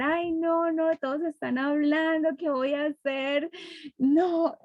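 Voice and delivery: high-pitched, playful mock worry; mock fretting. Host imagining a shy, tongue-tied audience, mock fretting. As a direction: fret in an exaggerated high voice. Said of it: "me los imaginé que iba a tener".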